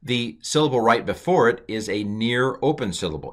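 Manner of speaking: ordinary delivery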